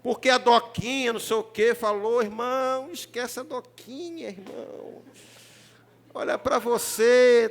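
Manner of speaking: usual speaking voice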